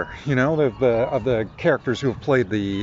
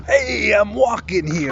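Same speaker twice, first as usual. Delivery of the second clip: mock New York accent; 9 semitones higher, slower than usual. Young actors practicing American accents in acting class, mock New York shout. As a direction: holler it like a hammed-up New Yorker. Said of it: "perfecting their American accent by going".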